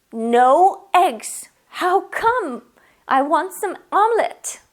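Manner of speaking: upset to find the fridge empty; strong dismay. Shown as a demonstration. Finding no eggs in the fridge, dismayed and loud.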